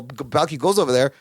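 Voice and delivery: normal talking voice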